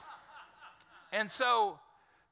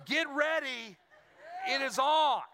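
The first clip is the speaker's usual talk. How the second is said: hammed-up playful threat, laughing through it, louder and higher; mock threat; 6 semitones higher, 4 dB louder, slower than usual. Dad telling his wife he'll deal with their son, a playful threat. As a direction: tease a showdown with a grin.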